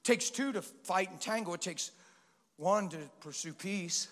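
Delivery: ordinary delivery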